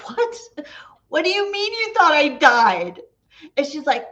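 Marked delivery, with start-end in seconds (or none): 0.1-3.0 high, loud retold disbelief; incredulous. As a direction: exclaim in mock shock, pitched way up. Retold shock at being thought dead.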